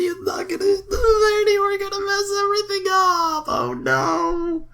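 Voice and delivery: high, hammed-up crying whine; mock wail. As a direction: wail like the game is ruined. Game going wrong, wailing.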